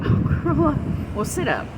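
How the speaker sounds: laughing through the words; giggly and high; giggling. Real, not acted. Giggling about curling up.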